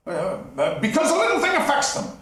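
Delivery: quick, high nervous laughter. Acted. Scrooge laughing nervously to brush off the ghost. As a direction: laugh it off to hide fear.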